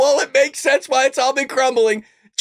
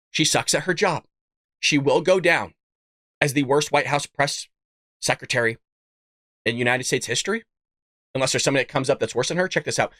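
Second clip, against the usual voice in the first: very low, softer deadpan monotone; low, restrained build-up to a reveal; low deadpan setup; 10 semitones lower, 4 dB quieter, faster than usual. Real, not acted. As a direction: drop right down and deliver the verdict drily.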